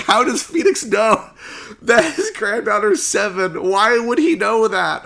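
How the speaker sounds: high, loud disbelief; incredulous. Retold or imitated. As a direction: ask it in baffled mock outrage. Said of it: "I was like".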